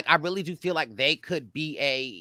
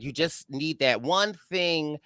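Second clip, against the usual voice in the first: ordinary talk, nothing marked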